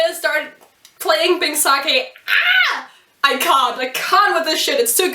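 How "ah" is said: very high overwhelmed fangirl squeal; squeal of emotion. Real, not acted. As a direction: squeal out the feeling, can't contain it.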